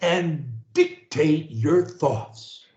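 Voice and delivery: very slow, each word spaced for repeating; slow dictation. Shown as a demonstration. Coach leading a client in repetition, very slow. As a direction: say it slowly for them to copy. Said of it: "say after me".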